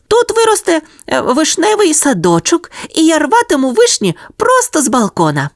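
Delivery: very high dreamy puppy voice; little dog musing. A narrator's reading. Sonya dreams of picking cherries, high puppy voice. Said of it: "подумала собачка Соня".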